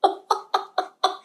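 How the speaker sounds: high, unvoiced giggle; breathy laugh. Real, not acted. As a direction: laugh almost silently, mostly air. Laughs at Kat throwing up.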